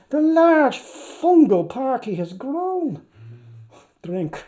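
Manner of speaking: ordinary delivery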